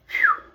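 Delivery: a high, loud puff of relief; relieved phew. Real, not acted. Owner exhaling with relief after the scare. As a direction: blow out the tension with relief.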